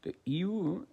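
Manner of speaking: normal talking voice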